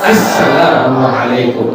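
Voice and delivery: Assalamualaikum slow and complete; every syllable of Assalamualaikum; careful proper salam; correct full greeting. Shown as a demonstration. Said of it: "Yaitu tadi apa?".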